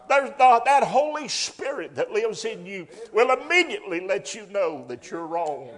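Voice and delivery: loud, high and urgent; preaching at full force. Acted. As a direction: push it out with fire.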